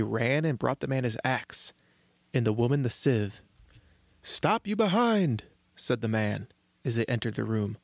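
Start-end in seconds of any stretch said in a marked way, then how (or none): 4.2-5.4 high, slow order; lightly acted urgent warning; commanding father. A narrator's reading. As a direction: call it out slowly, pitched up. Father orders wife before attacking wolf.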